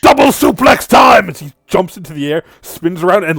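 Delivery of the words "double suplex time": very loud, high shouted wrestling call; battle cry. Acted. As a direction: yell it like a pro wrestler slamming down.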